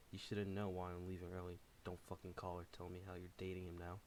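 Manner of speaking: plain reading voice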